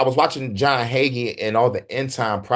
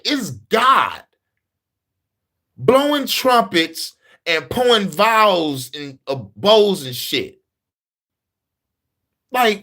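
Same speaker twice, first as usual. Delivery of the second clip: incredulous rant; 6 semitones higher than usual. Real, not acted. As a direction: spell out each horror in awe. Building amazed rant on Revelation.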